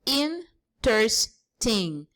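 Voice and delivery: interesting stretched out slowly, each syllable apart; slow syllable count. Shown as a demonstration. Teacher slowly sounding syllables for the class. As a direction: lay out interesting slowly so they hear the syllables. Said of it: "three syllables".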